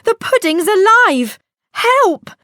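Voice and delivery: high, loud alarmed shriek; frightened cry. Acted. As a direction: panic at the jumping pudding. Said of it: "she said".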